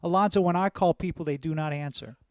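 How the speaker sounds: ordinary delivery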